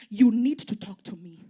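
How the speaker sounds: crying daughter demanding an answer; pleading demand. Retold or imitated. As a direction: insist through tears. Crying girl demands mother talk.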